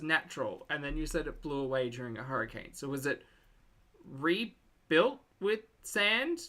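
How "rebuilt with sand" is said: REBUILT WITH SAND spaced out slowly, pitched high; baffled question. Real, not acted. Viewer confused by the island story, slow baffled "rebuilt with sand". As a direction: draw out each word of "rebuilt with sand" in puzzlement.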